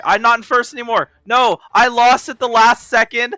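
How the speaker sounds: fast, high, loud frustrated rant; excited outburst. Real, not acted. Losing first place at the end, loud outburst. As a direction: blurt it out in disbelief.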